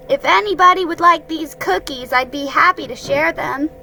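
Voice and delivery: little Roo. A narrator's reading. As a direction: offer it up brightly like a little boy. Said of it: "he said".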